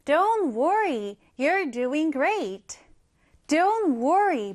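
Don't worry, you're doing great, read very slowly and clearly; slow example read. Shown as a demonstration. Teacher reading the example sentence slowly to the class. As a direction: spell it out slowly for learners. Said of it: "예문 한번 살펴볼게요".

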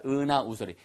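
normal talking voice

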